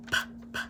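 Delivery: "p, p" as an unvoiced puff; whispered p sound. Shown as a demonstration. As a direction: breathe out "p, p" without voice. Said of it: "what do you guys hear in nap".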